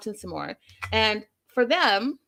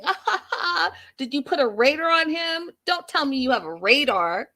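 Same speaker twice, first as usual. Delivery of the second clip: higher-pitched imitation of scornful laughing; mocking laughter; 5 semitones higher than usual. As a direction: mimic her laughing at him, voice lifted.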